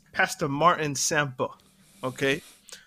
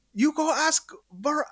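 higher, slower mock Ugandan accent; imitated Ugandan accent; 12 semitones higher than usual. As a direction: quote the pastor in a put-on Ugandan accent. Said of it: "let me not do the accent".

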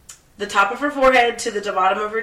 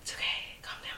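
whispered, to herself; calming herself down; 17 dB quieter, faster than usual. Real, not acted. Ranting about fitness accounts, then whispering herself calm. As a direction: hush yourself after a rant.

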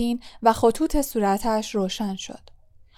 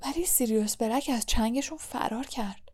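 said much softer; a soft quiet remark; softly; 8 dB quieter than usual. A narrator's reading. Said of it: "آهسته گفت".

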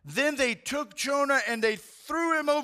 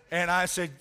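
normal talking voice

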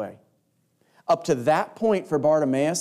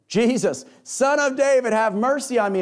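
passionate cry; 8 semitones higher than usual. Acted. Blind beggar crying out to Jesus, high desperate plea. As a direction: cry out to him with all your hope. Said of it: "you can hear the passion in Bartimaeus' voice".

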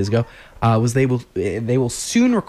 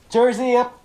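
higher-pitched show title; segment announcer; 10 semitones higher than usual. Shown as a demonstration. Host trying out a segment name, announcer voice. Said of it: "gotta figure out how to do it".

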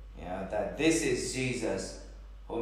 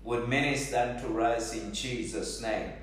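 ordinary delivery

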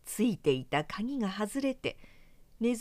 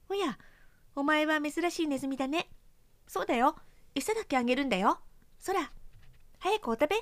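put-on higher, gentle old trap coaxing a mouse; kindly mousetrap; 6 semitones higher, faster than usual. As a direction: coax the little mouse to eat. (優しく誘うネズミ捕りの声)

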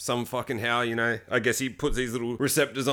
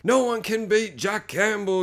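higher, slow triumphant boast; boastful sound guy; 8 semitones higher, slower than usual. Retold or imitated. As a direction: crow it slowly, higher. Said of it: "he's like".

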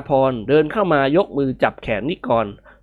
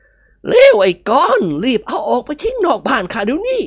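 put-on higher, pressing old man's voice; urgent old master; 9 semitones higher than usual. Old master urging Nikorn to throw it out. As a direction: hurry him out with dread.

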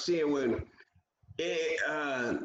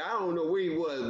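ordinary talk

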